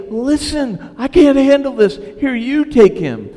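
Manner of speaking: loud, high, desperate handoff; acted-out end-of-rope pleading; frazzled outburst. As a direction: burst out like an overwhelmed parent. Frazzled parent handing over the baby.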